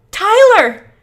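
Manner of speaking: very high, loud cry; screaming the name. Acted. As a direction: scream his name through tears. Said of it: "Tyler screams".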